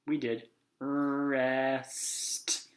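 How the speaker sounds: very slow, drawn-out, drowsy; sleepy drawl. Acted. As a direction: stretch it out like falling asleep. Drowsy nap-time voice.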